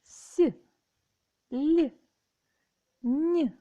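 С, Л, Н called out high and loud one by one; clear drilled sounds. Shown as a demonstration. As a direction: sound out С, Л, Н crisply for the class. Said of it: "м'які приголосні лагідніші".

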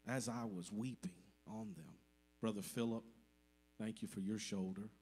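ordinary talk

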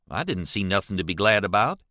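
ordinary delivery

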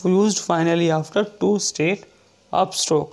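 very slow, word by word; teacher dictating a rule; slow dictation. Shown as a demonstration. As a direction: read it out for notes. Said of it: "dictating rule slowly".

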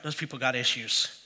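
low, dismissive aside about other people; a lightly voiced judgy churchgoer; dismissive mock voice. Preacher voicing judgy listeners, low and dismissive.

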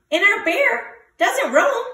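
high squeaky little mouse. A narrator's reading. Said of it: "Squeak Mouse squeaks".

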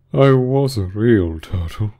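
slow, sighing lament; sighing Mock Turtle. A narrator's reading. Mock Turtle beginning his history, slow and sighing. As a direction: draw it out like a mournful sigh. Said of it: "at last with a deep sigh".

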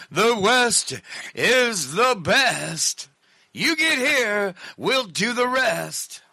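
high, slow crooned rock-star line. Retold or imitated. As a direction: drawl it out like a rock singer. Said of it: "what did [name] say?".

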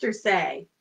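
normal talking voice

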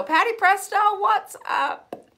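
very high, loud playful greeting; excited shout-out. Real, not acted. Host greeting viewer Patty Presto, high excited call.

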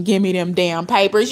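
ordinary talk

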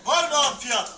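loud calling for bids; auctioneer. Acted. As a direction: call out for a bid.

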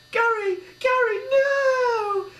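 very high, loud crying scream; despairing scream for the shot postman; anguished cry. A narrator's reading. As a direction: wail over the fallen friend. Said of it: "cried Linda Lyme".

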